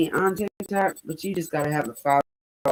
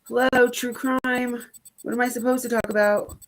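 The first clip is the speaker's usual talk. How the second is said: sarcastic duh; 7 semitones higher than usual. Retold or imitated. Mocking retort to husband.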